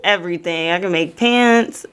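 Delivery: ordinary delivery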